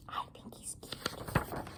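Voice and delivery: hushed breathy aside; whisper. Real, not acted. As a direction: whisper it like a secret. Whispered aside about baby.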